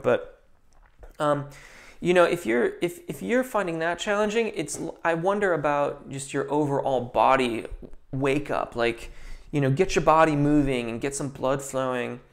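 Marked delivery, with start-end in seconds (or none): none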